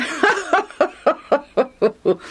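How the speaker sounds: long, high run of laughs; hearty laughter. A narrator's reading. Mister Legion joining Lucius in laughter, heartily. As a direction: laugh along with great enjoyment. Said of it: "joined Lucius in laughter".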